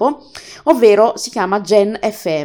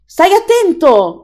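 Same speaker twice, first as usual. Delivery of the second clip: high, louder, drawn-out demo shout; demonstrated yell; 8 semitones higher, 5 dB louder, much slower than usual. Shown as a demonstration. Host showing how the reader voices "urlò", mock yell. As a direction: call it out slowly, pitched well up. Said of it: "usa un tono molto più alto del solito".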